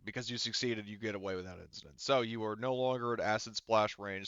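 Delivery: usual speaking voice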